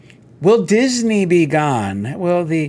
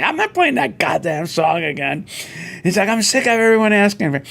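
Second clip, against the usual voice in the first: mock annoyed pianist; 6 semitones higher than usual. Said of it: "he would get mad".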